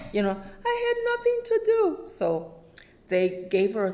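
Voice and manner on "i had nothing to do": much higher, quick little voice; Sherry voiced. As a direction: chirp the excuse lightly. Sherry's excuse for returning.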